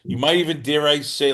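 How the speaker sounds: ordinary delivery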